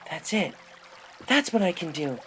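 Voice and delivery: excited realization. A narrator's reading. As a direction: blurt it out as the idea hits. Rainbow's sudden realization.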